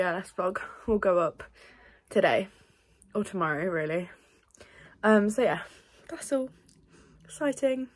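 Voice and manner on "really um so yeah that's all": usual speaking voice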